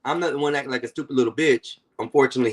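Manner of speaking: ordinary talk, nothing marked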